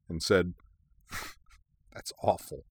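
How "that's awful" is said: soft, breath of a laugh into the words; guilty snort. A narrator's reading. Officer amused by a patient's dark joke, guiltily. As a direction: try not to find it funny. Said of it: "a little guiltily".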